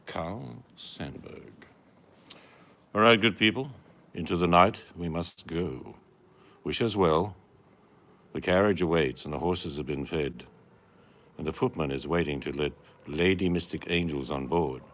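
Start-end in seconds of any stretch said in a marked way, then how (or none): 2.9-14.8 put-on loud, grand old-fashioned declamation; theatrical herald. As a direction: proclaim it like a coachman's herald. Theatrical farewell speech.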